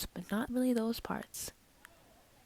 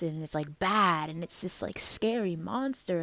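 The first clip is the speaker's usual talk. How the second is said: ordinary talk, nothing marked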